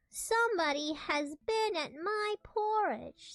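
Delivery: lightly voiced big bear; slow, heavy papa bear line; great big bear voice. A narrator's reading. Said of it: "in his great rough, gruff voice".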